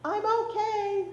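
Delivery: put-on high, slow little boy echo; high sweet voice. A narrator's reading. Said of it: "in his high, sweet voice".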